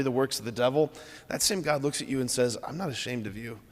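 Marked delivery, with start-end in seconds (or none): 2.6-3.6 soft, low, gentle promise; hushed, tender words to a beloved friend; tender reassurance. Acted. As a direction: say "I'm not ashamed of you" softly, as if close to someone hurt. Jesus speaks tenderly to listener.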